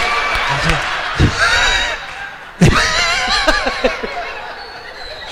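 big shouted laugh; laughing hard; real laughter; long laughter. Real, not acted. Huge laugh.

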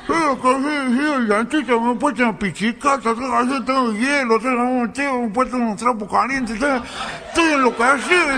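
high, muffled moaning through a swollen cheek; hammed-up agony, words mushed as if the mouth is stuffed; swollen-mouth toothache. Nero moaning to his friend about his toothache, swollen-mouthed. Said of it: "parecía que traía una bocha dentro de la boca".

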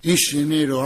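usual speaking voice

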